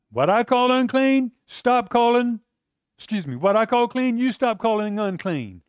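high, firm correction; God correcting. Acted. God rebuking Peter.

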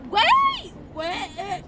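very high, cracking elf squeal; christmas elf voice. Acted. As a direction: shout to the kids like a giddy elf. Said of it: "practicing my elf voice all year".

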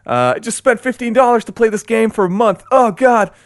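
high, loud exasperated self-scolding; mock regret. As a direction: groan at wasting money on the game. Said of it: "you think, like".

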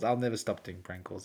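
usual speaking voice

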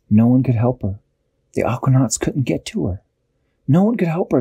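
ordinary delivery

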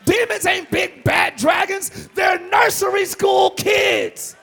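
loud, building shouted sermon; full-force preaching. Acted. Preacher to congregation casting out demons, shouting. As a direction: hammer each line at the congregation.

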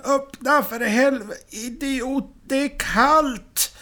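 high, exasperated character yell; furious storybook shouting, pitched high. A narrator's reading. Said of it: "skriker Sickan".

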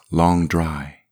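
ordinary delivery

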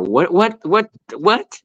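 high, rising mock disbelief; imitated furor. Voicing everyone online after the coach's remark, mock furor. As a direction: stammer it in mock shock, pitch climbing. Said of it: "everyone went in a furor".

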